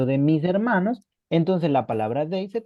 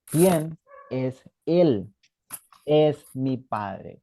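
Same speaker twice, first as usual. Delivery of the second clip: slow example; much slower than usual. Shown as a demonstration. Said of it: "si yo quiero decir".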